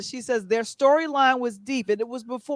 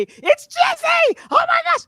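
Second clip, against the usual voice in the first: crazy fan squeal; 7 dB louder than usual. Retold or imitated. The crazy fan she refused to be, squealing at the actor. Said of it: "I couldn't go at him and say".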